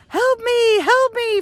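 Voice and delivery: high-pitched holler for help; mock screaming. Screaming for help from the rock, mock screaming. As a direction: cry out high, hammed-up desperation. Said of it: "from the top of my lungs".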